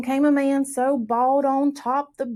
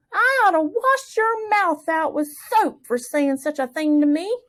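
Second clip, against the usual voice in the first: prissy lady scolding; 7 semitones higher, 4 dB louder than usual. A narrator's reading. Mayor's wife scolding Plug.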